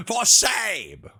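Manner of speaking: loud playful for shame; mock scolding. Streamer loudly mock-scolding chat, playful. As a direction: scold them grandly, in jest.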